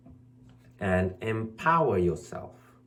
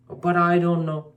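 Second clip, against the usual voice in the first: mock protest; 5 semitones higher, 6 dB louder than usual. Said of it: "They'll say".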